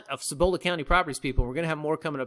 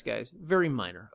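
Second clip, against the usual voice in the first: very minor leaned on, softer; stress on very minor; 6 semitones lower, 4 dB quieter than usual. Shown as a demonstration. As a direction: press on very minor. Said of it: "let me emphasize".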